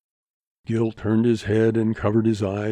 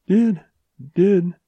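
higher, louder anxious stammer; distressed; 8 semitones higher, 7 dB louder than usual. A narrator's reading. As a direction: stumble on the words in alarm. Girl breaks off, distressed.

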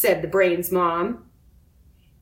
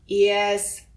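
ordinary delivery, nothing marked

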